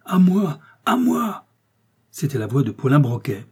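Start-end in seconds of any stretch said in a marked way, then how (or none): 0.1-1.5 high desperate cry for help; calling for help. A narrator's reading. Wounded detective cries out for help from the floor, desperate call. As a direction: cry out, much higher and louder. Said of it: "Puis une voix appela".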